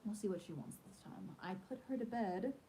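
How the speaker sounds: ordinary delivery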